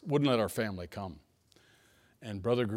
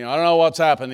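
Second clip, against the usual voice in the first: loud, higher, angry burst; angry caller; 5 semitones higher, 13 dB louder than usual. Retold or imitated. Madder than a wet hen, loud and high. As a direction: pastor retells friend's furious phone call: loud, high angry voice. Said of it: "his voice was different".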